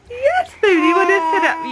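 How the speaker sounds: high cooing. Real, not acted. Cooing at baby.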